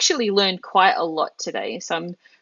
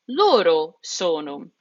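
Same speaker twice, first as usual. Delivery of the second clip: ordinary talk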